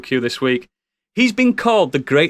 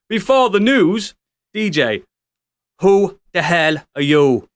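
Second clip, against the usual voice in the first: mock Irish voice; 4 semitones higher than usual. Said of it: "for an Irish voice to come out".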